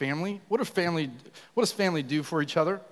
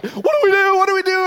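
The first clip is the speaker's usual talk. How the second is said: high, loud mock panic; hammed-up flustered wife; imitating panic; 11 dB louder, faster than usual. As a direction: play the frantic wife, squealing. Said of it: "you see her panicking".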